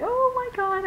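sudden high squeal; excited. Real, not acted. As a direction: burst out in giddy anticipation. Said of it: "I'm excited".

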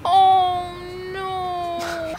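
high, drawn-out wail; dismayed wail. Real, not acted.

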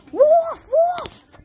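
very high mock yelps; crying wolf. Partner can't make out the words, very high yelping. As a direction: yelp it right up high. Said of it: "You're saying wolf, wolf".

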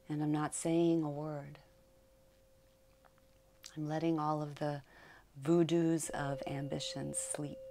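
meditative poem reading. Acted. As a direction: read the poem softly, letting each phrase rest.

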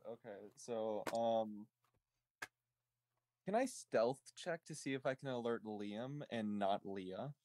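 ordinary talk, nothing marked

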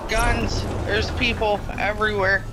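sudden high alarmed cry; panicking gamer. Real, not acted. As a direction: yelp out the warning in alarm. Enemies suddenly all around.

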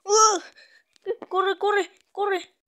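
high, loud panicked yells; being chased. Real, not acted. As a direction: scream while running away. (perseguido, gritando)

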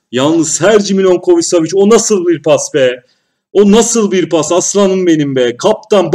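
loud, thrilled raving about a pass; excited praise. Real, not acted. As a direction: rave about the pass to the viewers.

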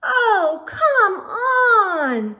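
high, fed-up groan. Acted. Squirrel fed up with her stuck friends, groaning. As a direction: moan it, out of patience. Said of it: "Squirrel groaned".

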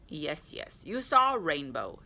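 lightly voiced fusty bird; a hint of a put-on character voice; mildly indulgent storybook reply; Owl. A narrator's reading. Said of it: "said Owl".

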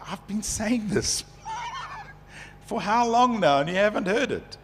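exasperated quote broken by a laugh; laughing exasperation. Retold or imitated. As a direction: laugh through the frustrated complaint. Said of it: "and you go like".

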